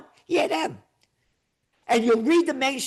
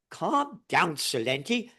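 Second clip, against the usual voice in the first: mock soothing; 4 semitones lower, 6 dB quieter, slower than usual. Mimics critics calming him.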